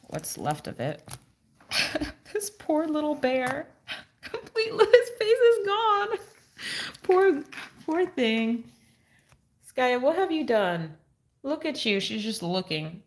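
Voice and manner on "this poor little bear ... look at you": put-on high, laughing baby voice over the ruined bear; pet talk. Owner to her dog about the destroyed bear, laughing high pet talk. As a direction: coo at the dog with amused pity. Pitying the torn toy, talking to dog.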